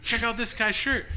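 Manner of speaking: high-pitched excited call to a colleague; imitated excitement. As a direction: act out a thrilled cast member. Retelling worker alerting a colleague.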